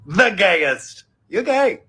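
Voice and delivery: normal talking voice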